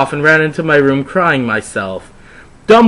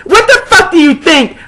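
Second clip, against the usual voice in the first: loud high snap with a laugh; hammed-up anger breaking into a laugh; mock fury, half laughing; angry; 5 dB louder than usual. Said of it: "I asked angrily".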